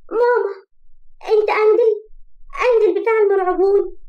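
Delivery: put-on high, loud frightened child; terrified scream. A narrator's reading. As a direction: cry out for mama, startled awake in terror. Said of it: "قامت مرعوبة من النوم". (صرخة طفلة مرعوبة)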